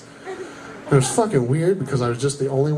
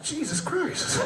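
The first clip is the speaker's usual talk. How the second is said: stunned mutter; 10 semitones higher, 8 dB quieter, slower than usual. Retold or imitated. Shock at drive-thru total.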